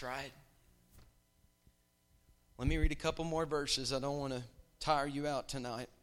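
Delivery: usual speaking voice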